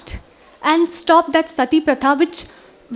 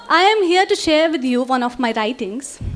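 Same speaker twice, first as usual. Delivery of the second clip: usual speaking voice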